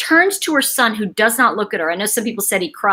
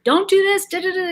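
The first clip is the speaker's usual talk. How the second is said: mock nagging mother; 8 semitones higher than usual. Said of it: "a friend who tells her daughter".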